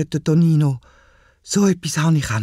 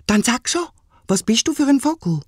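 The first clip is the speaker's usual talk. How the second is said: put-on much higher boyish push; brave boy; 9 semitones higher than usual. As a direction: summon courage and demand an answer. Boy challenges the bird.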